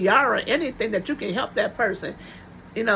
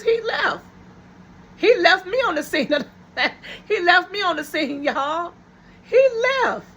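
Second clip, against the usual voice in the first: tearful high-pitched disbelief; 6 semitones higher than usual. Retold or imitated. Retelling being left at the accident.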